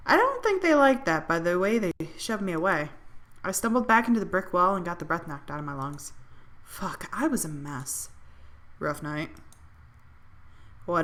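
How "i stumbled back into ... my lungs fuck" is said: plain reading voice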